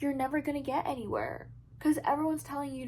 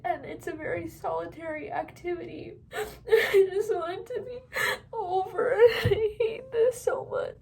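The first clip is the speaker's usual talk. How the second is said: real tears breaking into helpless laughs; laughing through tears; 7 semitones higher than usual. Real, not acted. Crying and laughing about thesis.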